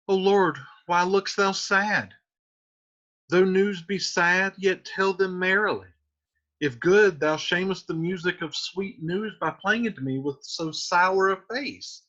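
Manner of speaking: put-on mild teasing young girl; Juliet, coaxing. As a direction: coax the nurse to smile. Reads Juliet's lines.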